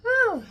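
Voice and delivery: big relieved whew. Real, not acted. Collecting herself after tears.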